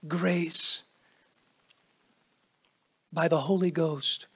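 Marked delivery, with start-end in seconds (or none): none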